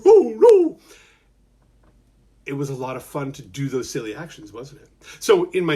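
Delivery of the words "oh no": high, loud mock fright; shivering like Cooper. Shown as a demonstration. The storyteller showing kids how Cooper shivers in a high mock fright. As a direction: play the scared little brother. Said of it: "shiver like Cooper".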